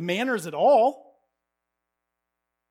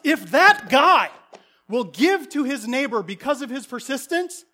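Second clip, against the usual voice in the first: preaching at full force; 2 semitones higher, 5 dB louder than usual. Acted. Preaching climax.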